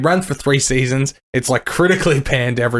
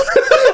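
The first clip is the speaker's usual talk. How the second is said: high-pitched, loud giggle; high laugh; 4 dB louder than usual. Real, not acted. Fan laughing at their own shock, high giddy laugh.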